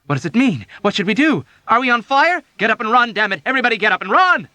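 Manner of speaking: high loud fast frantic orders; panicked shouting. A narrator's reading. Patient yelling the ward to run. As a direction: shout everyone out of bed in panic.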